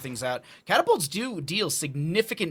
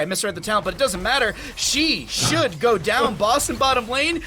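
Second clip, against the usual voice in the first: much higher, louder hype call; excited esports caster; 9 semitones higher, 6 dB louder than usual. Acted. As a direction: yell the play with full energy. Excited casting of fight.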